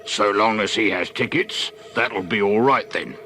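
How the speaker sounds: gruff, grudging gatekeeper. A narrator's reading. Gatekeeper bars the way into Universal Park, gruffly. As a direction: lay down the rule gruffly. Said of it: "the gruff voice".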